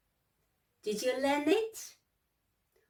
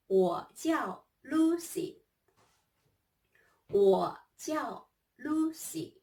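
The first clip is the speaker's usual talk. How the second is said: slow careful Chinese; 5 semitones higher than usual. Shown as a demonstration. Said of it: "let me introduce myself first".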